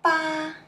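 Ba held high and level; first tone. Shown as a demonstration. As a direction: sustain Ba on one high note. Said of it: "Let's see more example".

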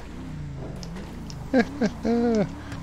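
taunting chuckle. Real, not acted. Driver mocks cops who can't follow, taunting chuckle. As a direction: chuckle quietly at stranded pursuers. Taunts pursuers from high spot.

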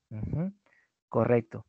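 ordinary talk

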